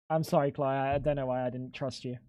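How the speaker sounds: normal talking voice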